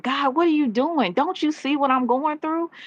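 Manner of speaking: exasperated cry skyward, pitched high; mock screaming. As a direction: imitate pleading with God. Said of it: "you're screaming at the, at the sky".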